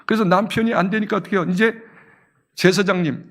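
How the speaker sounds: ordinary talk, nothing marked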